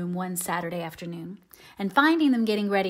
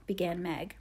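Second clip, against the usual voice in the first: usual speaking voice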